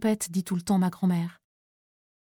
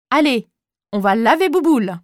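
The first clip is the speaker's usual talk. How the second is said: mocking bully; 3 semitones higher, 6 dB louder than usual. Bully drags him to showers.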